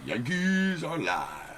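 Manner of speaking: high, slow drawn-out announcer call. Acted. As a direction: stretch it out like a ring announcer. Sung-out announcement of team.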